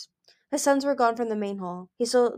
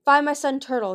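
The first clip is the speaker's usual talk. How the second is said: loud, higher angry command; snarl; 6 semitones higher, 6 dB louder than usual. Acted. Gil ordering a gawking dragon, snarling. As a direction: bark the order at them. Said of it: "he snarled".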